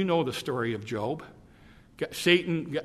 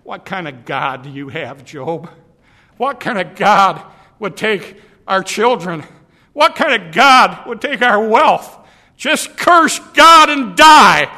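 much louder, higher furious outcry; angry wife; 5 semitones higher, 15 dB louder than usual. Acted. Grieving wife rails at Job, screaming in anger. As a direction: wail it at Job in rising fury. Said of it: "Job's wife said".

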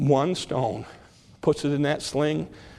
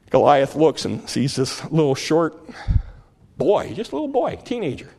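ordinary delivery

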